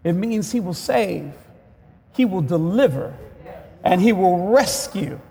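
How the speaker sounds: ordinary talk, nothing marked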